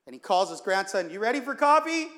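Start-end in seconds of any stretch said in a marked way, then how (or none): 1.1-2.1 high, raised call. Acted. Grandfather calls his grandson to coffee, calling out. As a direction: call over brightly pitched up, louder.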